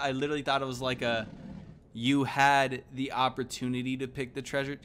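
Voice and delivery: ordinary delivery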